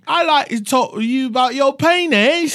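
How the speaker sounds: high, loud mock British voice; British accent. Riffing crude lines with co-host, hammed-up British accent. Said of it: "Can you do a British accent?".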